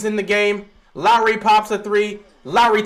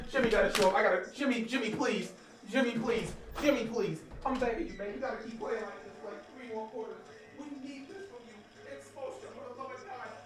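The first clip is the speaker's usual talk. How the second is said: much softer, pleading under the breath; quiet begging; 14 dB quieter than usual. Real, not acted. Fan begging Jimmy under his breath, quiet pleading. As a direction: beg quietly, almost praying.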